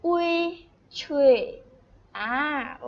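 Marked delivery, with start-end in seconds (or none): 0.1-1.3 อุย held, then blended into a loud, long ชุย; sounding out a syllable. Shown as a demonstration. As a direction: model อุย and ชุย as high, level syllables. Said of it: "ก็เป็นชื่อ".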